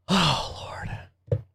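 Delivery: breathy whisper of dismay; whispered dread. Real, not acted. The host looks at the news and whispers in dread. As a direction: whisper it like bracing yourself.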